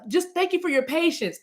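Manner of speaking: high-pitched customer-service politeness; lightly voiced cashier. Clerk thanking waiting customers, lightly imitated in high voice. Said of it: "And then she even goes".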